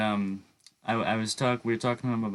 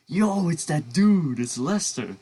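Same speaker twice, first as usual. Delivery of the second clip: excited fan; 8 semitones higher, 6 dB louder, slower than usual. Retold or imitated. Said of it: "Maybe some people will be like".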